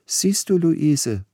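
a fussy lady teaching her daughter; Leipzig lady. A narrator's reading. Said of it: "sprach die Dame".

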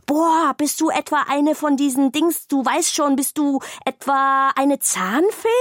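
boah, amazed to meet a fairy; awestruck bag. A narrator's reading. Bag realising Zora is a tooth fairy, amazed.

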